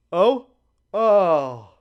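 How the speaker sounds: big noisy yawn in two bursts; loud yawn. Real, not acted. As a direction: yawn out loud, caught off guard. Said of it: "I thought I was done".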